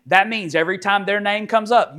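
usual speaking voice

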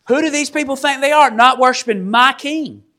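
high, quick indignant challenge; indignant outrage. Acted. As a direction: act affronted, pitch up. Voicing the offended Babylonians.

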